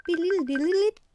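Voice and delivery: high, loud mimicked electronic beeping; imitated beeping. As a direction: chirp it out like a Skype tone. Imitating the strange Skype noise.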